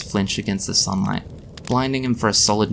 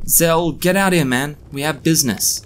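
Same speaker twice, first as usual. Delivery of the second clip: higher call through a metal door; 7 semitones higher than usual. A narrator's reading. Banging on door calling Zell.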